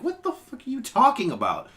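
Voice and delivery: mock-furious disbelief at the commenters; high-pitched incredulous rant; exasperated outburst. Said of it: "I'm like".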